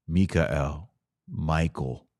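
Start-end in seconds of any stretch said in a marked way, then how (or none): none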